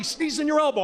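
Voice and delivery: mock nag; mild mock yell. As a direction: scold him like a fed-up girlfriend. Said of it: "She's always yelling at me".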